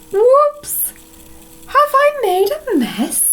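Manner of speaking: high-pitched, playful kitten voice; kitten's oops. Acted. Kitten owning up to a mess, high playful oops. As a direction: squeak it like a kitten caught out.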